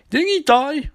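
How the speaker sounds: high, incredulous question; mock disbelief. Joe asks the team about Ronnie, mock disbelief. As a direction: squeak out an imitated astonishment. Joe baffled by a dead man returning.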